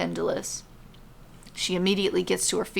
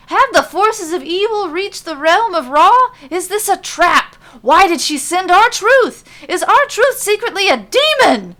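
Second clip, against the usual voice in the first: from raised to a high, loud near-shout; louder and louder; 9 semitones higher, 10 dB louder than usual. Acted. Big E to friends, rising from loud to frantic shout. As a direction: build each question into alarm. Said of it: "his voice getting louder and louder with each new question".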